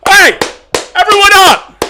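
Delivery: high, loud rallying call. Real, not acted. Streamer calling everyone to their feet, loud. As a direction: rouse the room.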